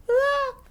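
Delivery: put-on very high loud child scream; sudden scream. Little brother crying out at the playground, high scream. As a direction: shriek out suddenly. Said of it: "갑자기 소리를 지릅니다".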